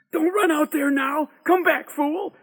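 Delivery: high, loud urgent call; urgent warning. A narrator's reading. Boy warning his friend not to run out, high urgent shout. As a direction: call him back in alarm.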